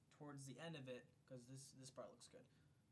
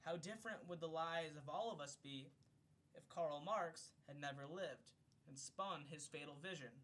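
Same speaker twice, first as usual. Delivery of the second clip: raised, clear read-aloud voice, higher and louder than his chat; formal book-reading voice; 5 semitones higher, 7 dB louder, slower than usual. Acted. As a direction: read it like an audiobook narrator. Reads a book foreword aloud.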